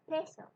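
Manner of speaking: peso with a clear puff of air on the p; aspirated peso. Shown as a demonstration. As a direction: breathe out on the p of peso. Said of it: "Do you feel a little puff".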